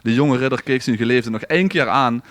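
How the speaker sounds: ordinary delivery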